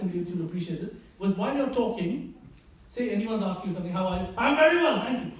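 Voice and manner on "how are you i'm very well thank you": posh British accent. Shown as a demonstration. An Indian showing off a proper British accent to a guest. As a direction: rise onto your toes mid-sentence in mock British. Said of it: "get on the tips of your toes".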